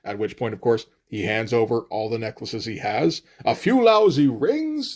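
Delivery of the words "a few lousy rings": high, drawn-out scornful question; mock diatribe. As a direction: scoff high and slow at the offer. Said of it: "starts to go into a diatribe".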